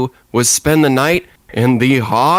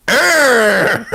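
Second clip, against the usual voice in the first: hammed-up, higher monster growl "Arr"; mock monster roar; 7 semitones higher than usual. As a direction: growl like a playful Frankenstein. Frankenstein growls in the story.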